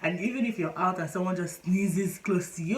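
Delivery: usual speaking voice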